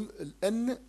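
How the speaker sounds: "الأن" with the نون swallowed; light n. Shown as a demonstration. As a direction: say "الأن" leaving the نون almost silent. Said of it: "ولكن النون لا أنطقها". ("الأن" بنون خفيفة)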